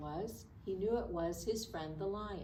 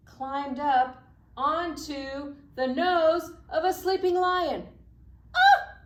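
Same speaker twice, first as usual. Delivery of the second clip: climbing higher and louder to a startled cry; dramatic rise; 9 semitones higher, 12 dB louder than usual. A narrator's reading. Librarian builds the story to a startled yelp. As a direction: build up then yelp in alarm.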